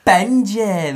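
high, loud mock yell; shouting at dog. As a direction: imitate an owner yelling at the dog. Imitates owner yelling at dog.

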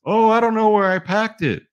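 high-pitched mocking wife impression; put-on high voice of a spouse playing innocent; mimicking wife. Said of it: "The wife won't unpack the belt".

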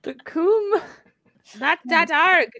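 high-pitched Irish "Dot com"; mock Irish accent. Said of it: "how you talk in an Irish accent".

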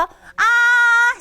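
아 held very high and level; exaggerated first tone. Shown as a demonstration. Teacher tells class to exaggerate, high sustained 아. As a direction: overdo 아 as one long high note. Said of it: "약간 오버한다는 식으로".

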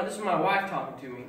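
usual speaking voice

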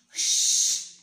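a sharp, loud shh; loud shushing hiss. A narrator's reading. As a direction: hush them hard with a long shh. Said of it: "whispered the hare".

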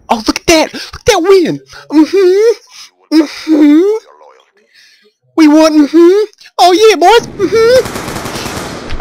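high, loud gleeful whooping; victory shout. Real, not acted. Yelling to the squad after winning the match, gleeful. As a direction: whoop it up over the win.

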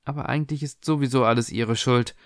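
ordinary delivery

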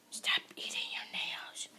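drawn-out hushed warning; slow whispered scolding. Pretend. Vlogger telling her to stop eating nails, whispered. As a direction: whisper it slowly, word by word.

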